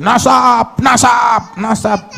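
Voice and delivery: high-pitched mocking cry of a boast; mock shrieking. Preacher mimics people who only brag of lineage, mock shrieking. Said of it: "Cuma jurit-jurit".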